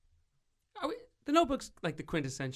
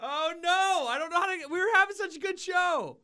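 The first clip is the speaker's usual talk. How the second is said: very high-pitched, hammed-up dismay; mock panic; 4 dB louder than usual. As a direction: wail it in a squeaky fake fluster. Mock panic over show going silent.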